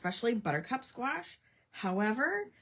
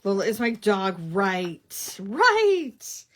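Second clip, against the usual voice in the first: emphatic loud; 12 dB louder, much slower than usual. Real, not acted. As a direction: insist it loudly. Emphatic correction of viewer.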